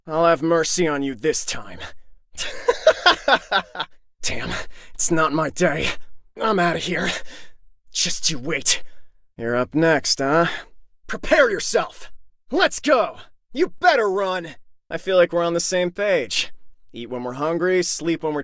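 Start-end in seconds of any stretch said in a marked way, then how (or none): 1.8-3.8 high-pitched staccato cackle; gloating laugh. Acted. Fighter mocking a beaten opponent, gloating cackle. 11.1-14.5 high-pitched, forceful call to fight; battle challenge. Acted. Fighter warning the next opponent, challenging shout.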